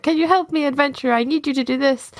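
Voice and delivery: sweet, humble little monk. Acted. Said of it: "this little monk".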